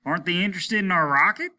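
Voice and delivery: high, loud, fast question; captain demanding answers; urgent question. Acted. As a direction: press him hard.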